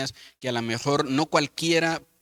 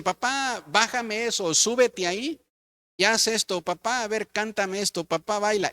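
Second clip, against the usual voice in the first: put-on higher, pestering kid's requests; imitating children; 7 semitones higher than usual. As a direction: play a child begging dad for fun. Children asking dad to play. (imitando a los niños, voz más aguda de petición)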